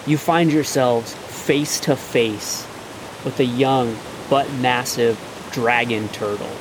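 slow, hushed build-up; game master scene setting. A narrator's reading. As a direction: draw the players in slowly, revealing a monster. GM describes the dragon turtle's emergence.

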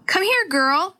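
high-pitched coaxing pet call. A narrator's reading. Girl calling to her lost cat, high coaxing call. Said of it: "she called".

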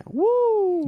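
high, loud victory whoop; excited cheer. Real, not acted. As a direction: whoop with joy at the win. Celebrating the won battle.

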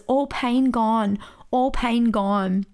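slow, spaced-out simple words; retold halting broken English, drawn out. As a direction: imitate halting English, very slowly. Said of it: "in her broken English".

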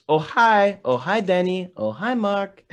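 high, singsong mock greeting; corny movie quote. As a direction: imitate a corny film line, pitched well up. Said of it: "another one that they always say".

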